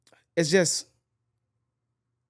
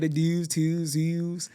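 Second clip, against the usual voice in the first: Baltimore accent imitation; much slower than usual. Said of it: "like, the Baltimore accent".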